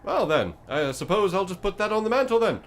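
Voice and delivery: high, loud, oddly cheerful creature; a chipper monster eager to bargain. Acted. GM's bone creature answers the party, high and chirpy.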